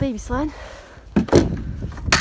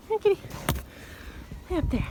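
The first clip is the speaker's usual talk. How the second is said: high sing-song kitty call; calling a cat; 8 semitones higher, 8 dB quieter, faster than usual. Real, not acted. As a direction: coax the cat down sweetly.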